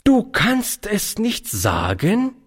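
slow, high, reproving echo rising to a question; reproachful. A narrator's reading. Aunt repeating brother's answer, reproachful. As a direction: repeat it slowly in punishing disbelief. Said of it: "wiederholte sie in strafendem Ton".

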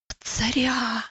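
awed storybook whisper; hushed, voiceless exclamation. A narrator's reading. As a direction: whisper it in wonder. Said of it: "прошептал Иосиф".

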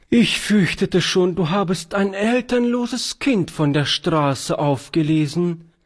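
lightly voiced old lady letting out relief; relieved. Charlotte, relieved the girl is no street orphan, high voice. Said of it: "meinte Charlotte sichtlich erleichtert".

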